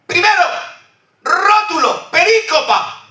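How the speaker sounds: high, ringing preacher's cry, building emphasis; full-force preaching. Acted. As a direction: proclaim it to the congregation. Preacher announces the reading.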